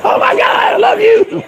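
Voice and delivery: high squealing fan voice; mock crying fan. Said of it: "people were crying".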